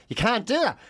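ordinary delivery

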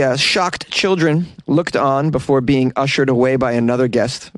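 ordinary delivery

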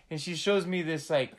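normal talking voice

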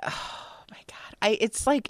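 breathless, whispered awe after a sigh; hushed and overcome, barely voicing it; real emotion catching the voice in a whisper; emotional whisper. Real, not acted. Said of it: "And, you know, I'm emotional".

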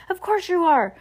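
high-pitched insistent retort; insistent shopkeeper. A narrator's reading. Shopkeeper argues back at the children, insistent.

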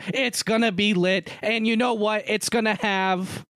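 building Rock-style promo hype; wrestling promo. Acted. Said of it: "why are you Dwayne The Rock Johnson in me".

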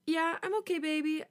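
high, cracking voice fighting back fear; trying to sound okay, voice breaking. A narrator's reading. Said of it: "as I hear my voice breaking".

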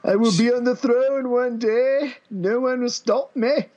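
put-on high, slow royal English accent; drawn-out, pompous royal-accent declaration; Prince Charles accent. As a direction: vow it grandly in a mock Charles accent. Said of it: "doing my Prince Charles accent".

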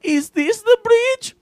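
slow, very high, loud falsetto; squeaky, drawn-out mock cartoon question; cartoon kid. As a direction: ask it like a children's show host. Acting out Dora asking viewers.

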